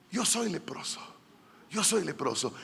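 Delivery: very soft, half-whispered admission; acted, barely voiced warning; hushed confession. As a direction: murmur it timidly, as an acted leper. Acts lepers warning Jesus from afar.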